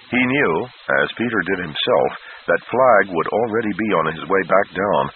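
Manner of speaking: ordinary delivery, nothing marked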